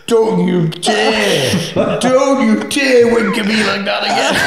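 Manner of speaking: loud, high mock-outraged line, slowed at first, laughing through it; hammed-up indignation collapsing into laughter; mock outrage. Dave's retold outraged reply.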